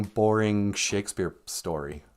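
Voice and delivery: ordinary talk, nothing marked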